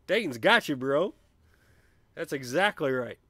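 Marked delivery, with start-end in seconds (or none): 0.1-1.1 lightly voiced, laughing chat quote, loud ooh; reading a chat comment. Host reading Beach Bear's chat comment, amused. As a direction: read the viewer's comment with a grin. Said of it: "Beach Bear says".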